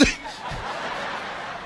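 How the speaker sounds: breathless, voiceless laugh fit; silent wheezing laugh. Real, not acted. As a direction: laugh without voice, trying to hold it in. Laughing at her fall.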